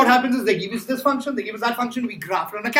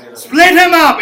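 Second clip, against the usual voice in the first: loud reminder; 7 semitones higher, 13 dB louder, slower than usual. Real, not acted. Class shouting recall.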